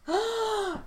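sharp high gasp; shocked gasp. Real, not acted. Hearing the story might be changed, shocked gasp. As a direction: suck in breath in shock.

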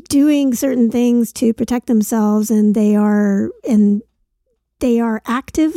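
usual speaking voice

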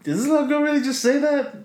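high-pitched incredulous question; retold disbelief. Walker reacts to little girl's remark, high mock-stunned voice.

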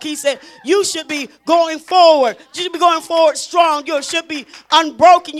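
rising to a loud preaching shout. Acted. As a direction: preach it harder and harder. Preacher building to full force.

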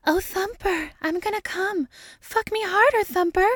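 very high put-on voice; hammed-up ecstatic yelling. A narrator's reading. Dana to her lover through motel walls, ecstatic yelling. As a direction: squeal it in mock ecstasy. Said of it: "Dana is yelling".

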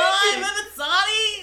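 high, squeaky, slow cartoon voice; cartoon kid voice. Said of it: "That was a really good impression of what you just sounded like".

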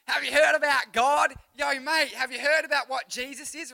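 loud, hammed-up Aussie shout; broad Australian. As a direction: holler it like an over-the-top Australian bloke. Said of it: "talking in Australian".

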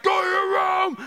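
high re-enacted dad's yell; retold angry scream. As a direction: act out losing your temper at your son. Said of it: "I got so angry".

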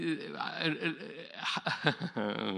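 real chuckle at his own story; a long, delighted chuckle; amused laugh. Real, not acted. Preacher enjoying his ironic story with the congregation, amused laugh.

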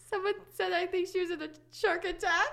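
reading a comment while laughing. Real, not acted. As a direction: laugh through the quoted comment.

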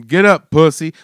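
loud, brusque imitation of a friend; loud mock drill order; retold taunting order. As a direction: shout it down at him. Friend barks at him, retold.

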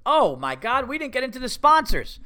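loud, high-pitched exclamation; sudden alarmed realization. Real, not acted. Host realizing he forgot the sponsors, alarmed outburst. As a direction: burst out as if you just remembered.

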